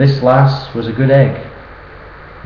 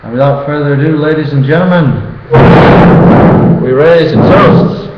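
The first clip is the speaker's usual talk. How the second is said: rousing toast; 4 semitones higher, 7 dB louder than usual. Real, not acted. Best man raising a toast.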